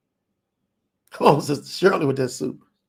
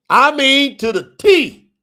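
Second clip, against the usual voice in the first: much louder and higher, hammering it home; emphatic outburst; 10 semitones higher, 11 dB louder, slower than usual. Real, not acted. Preacher telling the congregation it happened exactly then, loud and emphatic. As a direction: shout it out to the congregation.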